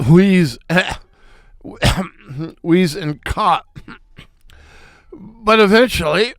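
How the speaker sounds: coughing and wheezing through the words. Acted. Trying to laugh, he wheezes and coughs. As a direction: cough and wheeze as you speak. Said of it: "I began to".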